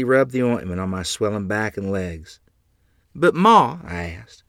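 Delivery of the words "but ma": high, loud appeal; pleading child. Acted. Child with sore back to mother, pleading.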